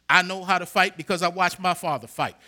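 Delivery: usual speaking voice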